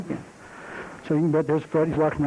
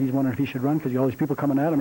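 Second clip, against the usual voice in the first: ordinary delivery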